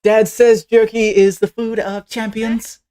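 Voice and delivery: put-on high, slow, emphatic child voice; eager eight-year-old girl. Girl offered jerky by her idol, high and eager. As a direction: declare it proudly, like an eager kid. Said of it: "she's an eight-year-old human".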